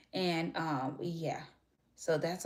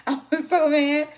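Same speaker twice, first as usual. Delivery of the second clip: much higher and louder, laughing it out; laughing outburst; 9 semitones higher, 11 dB louder than usual. Real, not acted. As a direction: blurt it loud after a laugh.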